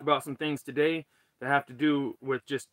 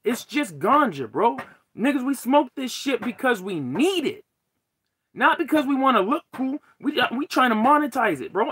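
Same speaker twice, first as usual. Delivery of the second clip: higher, louder, fed-up tirade; heated rant; 6 semitones higher, 4 dB louder than usual. Real, not acted. Streamer ranting to viewers about everything being fake, heated. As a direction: rant with real frustration, hammering the stressed words. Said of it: "you guys can see I'm fucking heated today".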